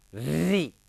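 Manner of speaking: "री" with a long, heavy rolled r before the vowel; obstructed, friction-filled री. Shown as a demonstration. Teacher proving friction in "री", the r exaggerated and drawn out. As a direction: drag out the r of "री" so the sound catches. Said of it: "ये तो एकदम break सा लग गया".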